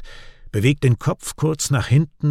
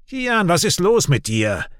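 higher-pitched, irritated demand; 8 semitones higher than usual. A narrator's reading. Raik questioning his silent brother Kian, irritated. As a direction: press him, annoyed. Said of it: "fragt Raik gereizt".

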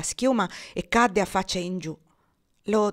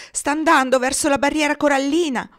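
higher, louder warning call; urgent shout; 6 semitones higher, 6 dB louder than usual. A narrator's reading. Girl warning that the boat heads for reef, loud urgent shout. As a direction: call the danger out over the wind. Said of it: "gridò".

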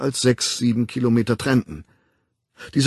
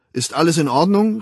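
called out, higher and louder; calling out; 7 semitones higher, 5 dB louder than usual. A narrator's reading. Helmholtz calling through the door, raised and concerned. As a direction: call anxiously toward the bathroom door. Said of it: "rief Helmholtz".

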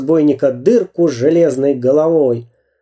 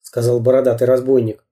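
plain reading voice

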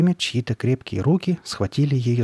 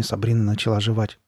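ordinary delivery, nothing marked

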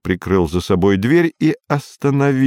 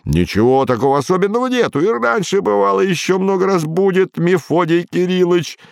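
cheerful; 8 semitones higher than usual. A narrator's reading. Petenka soothing old man.

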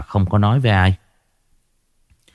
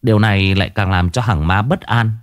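ordinary delivery

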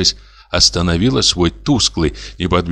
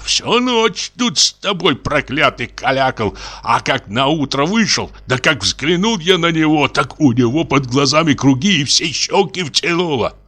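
higher, half-weeping peasant rant; tearful sexton; 7 semitones higher than usual. Acted. Sexton tearfully lists his wife's sins, higher. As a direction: accuse her through tears, building grievance. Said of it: "глухим, плачущим голосом".

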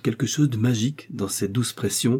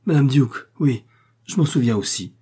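higher, louder exclamation at first; crying out; 4 semitones higher, 4 dB louder than usual. A narrator's reading. Patient recalls his cousin's marriage, crying out. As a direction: cry out the name, then ease off. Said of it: "il s'écria".

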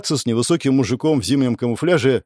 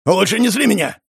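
menacing threat; 7 semitones higher than usual. A narrator's reading. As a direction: threaten him to his face. Thug jabbing finger, threatening.

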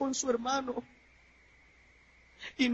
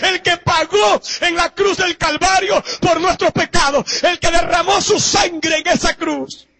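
shouted, higher, slowed preaching; preacher shouting about Jesus to the congregation; preaching at full force; 8 semitones higher, 12 dB louder, slower than usual. Acted. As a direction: thunder it from the pulpit. Preaching climax.

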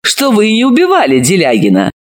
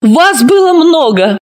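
hidden triumph; 7 semitones higher than usual. Acted. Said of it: "скрывая торжество".

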